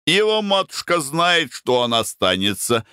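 put-on high cocky young voice; brash young dandy. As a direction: toss it off cheekily. Foker's brash reply.

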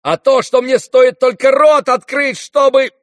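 very high, loud threat, broken off; shrieked outburst left unfinished; frantic yell. Acted. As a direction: yell at the top of the voice. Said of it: "завопил вдруг Ганя и не договорил".

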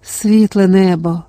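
ordinary delivery

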